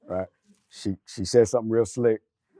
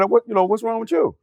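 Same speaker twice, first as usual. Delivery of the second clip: lightly raised voice imitating the wife, quick; quoting his wife with a lifted, quicker voice; mimicking his wife; 8 semitones higher, faster than usual. Husband retelling wife's question, lightly higher imitation. Wife asks in retold quarrel.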